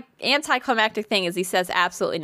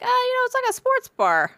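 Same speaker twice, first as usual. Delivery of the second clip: much higher, falsely innocent; high-pitched innocent voice. Retold or imitated. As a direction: play it sweet and innocent. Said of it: "And I was like".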